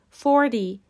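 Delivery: forty with a loud falling FOR; forty, stress up front on FOR. Shown as a demonstration. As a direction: punch the first syllable of forty, FOR-ty. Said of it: "تنها تفاوت این دو عدد".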